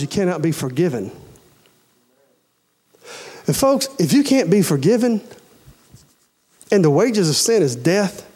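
ordinary delivery

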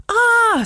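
high, loud acted scream; sudden high-pitched cry of alarm; storybook scream. A narrator's reading. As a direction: scream "Ah!" as a frightened pupil. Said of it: "screamed Samantha".

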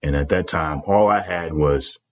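usual speaking voice

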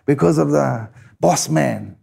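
imitated elder holding back, slow; old fella warning. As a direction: caution the boy like a wary old man. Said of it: "And then he would say".

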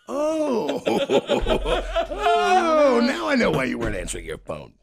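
high, loud laughing dawning; laughing realisation. Retold or imitated. Friend realising why Joe didn't answer, laughing. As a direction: crack up as it sinks in.

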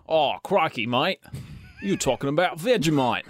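hammed-up Australian accent; mock Australian accent. Co-host corrects the others about Vegemite, in a broad mock Australian accent. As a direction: exclaim like a cartoon Australian. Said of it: "Vegemite's the Australian one".

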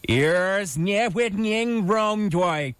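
Ears nie with ning wrong doy said slowly, each reversed syllable apart; slow careful Ears nie with ning wrong doy, a phrase read backwards; backwards speech. Shown as a demonstration. Host tries a backwards message for listeners, slow and careful. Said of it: "a message for our community here".

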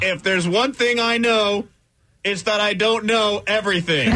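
mock-solemn graduation wisdom; pompous speaker. Parodies JJ Watt's line.

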